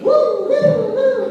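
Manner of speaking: high, loud woo-woo-woo; three high hooting woos; happy brain whoops. Acted. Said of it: "Your brain goes".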